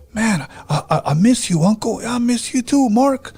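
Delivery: high, eager, affectionate phone chat; high-pitched happy imitation; cheerful dream call. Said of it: "he sounded so cheerful".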